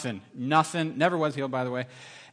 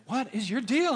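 puzzled Brad; 8 semitones higher, slower than usual. Retold or imitated. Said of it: "Brad's like".

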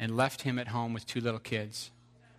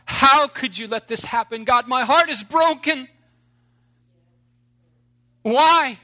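desperate wailing cry to God, much higher and louder; anguished crying out; 15 dB louder than usual. Acted. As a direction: scream your grief at God, building to a howl.

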